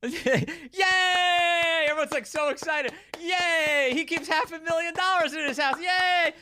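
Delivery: high, hammed-up fake cheer; mock cheering. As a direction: squeal like a paid crowd, sarcastically. Mocking the paid applause.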